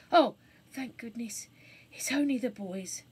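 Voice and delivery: whispered relief; girl whispering in relief; relieved whisper. Acted. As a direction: breathe it out in relief. Jane relieved.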